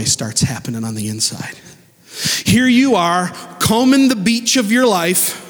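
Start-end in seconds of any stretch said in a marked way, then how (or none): 2.4-5.5 fervent, lifted sermon voice over music; preaching. Acted. Pastor preaching to the congregation, full fervor.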